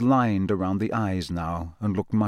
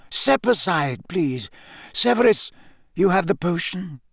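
composed wizard headmaster; 7 semitones higher than usual. A narrator's reading. Headmaster asking his colleague for the potion, composed command. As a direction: give polite orders in a lifted voice. Dumbledore takes charge.

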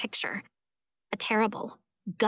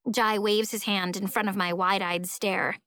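ordinary delivery, nothing marked

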